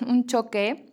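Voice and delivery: normal talking voice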